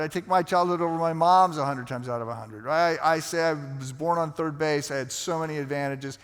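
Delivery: ordinary delivery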